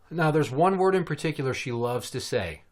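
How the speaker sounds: ordinary talk, nothing marked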